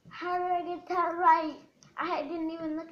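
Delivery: loud, high amazed question. Real, not acted. Kid guessing colours, loudly amazed at getting it right. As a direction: blurt it out, thrilled.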